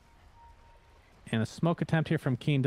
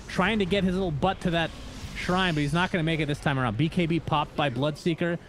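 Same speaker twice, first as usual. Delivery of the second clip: excited, higher-pitched esports commentary; live play-by-play; 4 semitones higher than usual. Real, not acted. Caster calling a team fight to viewers, excited play-by-play. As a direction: call the fight as it unfolds.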